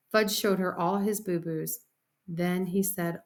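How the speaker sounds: usual speaking voice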